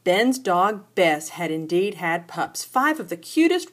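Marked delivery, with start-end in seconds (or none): none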